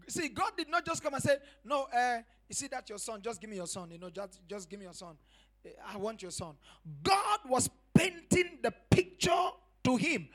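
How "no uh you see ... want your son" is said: softer, easy throwaway request; casual offhand ask. Pretend. God asking Abraham offhandedly, soft and casual. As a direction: toss it off lightly, as if it barely matters.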